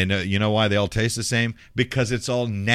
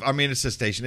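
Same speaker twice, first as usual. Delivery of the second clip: ordinary delivery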